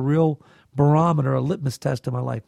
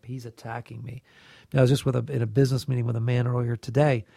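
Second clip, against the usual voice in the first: ordinary delivery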